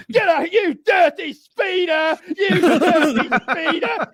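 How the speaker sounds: very high, slow, hammed-up shout with a laugh; mock furious shout. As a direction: yell at the speeder like a crazed bobby. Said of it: "the British cop is dragging him out".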